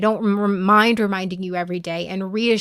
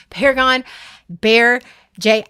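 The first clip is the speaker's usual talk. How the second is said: each name punched louder and higher; single out each friend, loud and firm; emphatic name-calling; 4 semitones higher, 6 dB louder than usual. Real, not acted. Streamer promising friends to show up, names called emphatically. Naming chat members emphatically.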